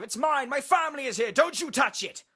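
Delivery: high, full-force frantic yelling; desperate, fiercely protective shout; possessive shouting. A narrator's reading. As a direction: scream at them to back off. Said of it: "shouted the detective".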